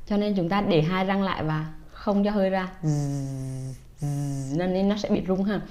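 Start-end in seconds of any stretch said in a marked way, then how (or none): 2.8-4.7 long voiced "Z" through closed teeth; "Z" hummed low, teeth together; buzzing z. Shown as a demonstration. Teacher drills the final z sound for parents. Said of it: "Âm cuối là âm z".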